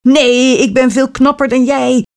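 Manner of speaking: jealous sisterly shouting match. A narrator's reading. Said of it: "schreeuwde de jongste".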